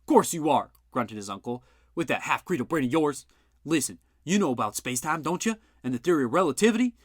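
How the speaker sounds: an uncle mocking his nephew's brain; scornful gruff uncle. A narrator's reading. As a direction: talk down to a dim nephew. Bud mocks Jack.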